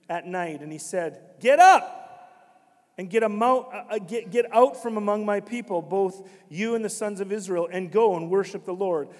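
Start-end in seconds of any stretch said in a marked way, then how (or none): 1.4-2.0 loud high barked order; loud order to leave; shouted command. Acted. Pharaoh ordering them out. 3.0-9.1 louder commanding order; loud order to leave. Acted. Pharaoh ordering them out.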